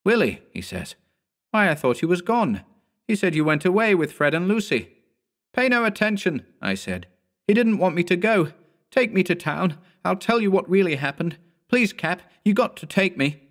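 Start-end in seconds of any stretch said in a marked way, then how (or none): 0.0-0.4 high, loud call of his name; startled recognition; surprised. A narrator's reading. Cap surprised to see boy. 1.5-4.9 high, raised puzzled voice; can't believe the boy is here; puzzled surprise. A narrator's reading. 5.5-13.4 high, urgent begging; begging to be taken along; desperate pleading. A narrator's reading.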